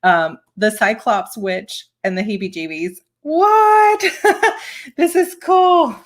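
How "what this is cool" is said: high, loud, delighted squeal; thrilled high-pitched reaction; excited squeal. Real, not acted. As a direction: gush over the gift with delight.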